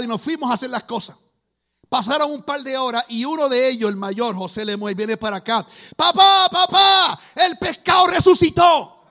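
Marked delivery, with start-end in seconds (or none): 6.0-9.0 high, loud calling out to dad; excited shout. Retold or imitated. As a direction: yell the news across the house. Son runs in shouting the news.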